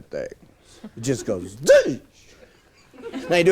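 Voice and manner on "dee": sharp high yelp of a zipper; a bright mock rip noise; mock zip sound. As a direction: squeal it like a zipper ripping. Horse's sound ripping clothes.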